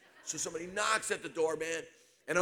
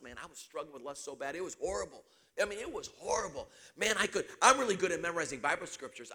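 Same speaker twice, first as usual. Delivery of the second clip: normal talking voice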